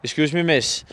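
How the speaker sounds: usual speaking voice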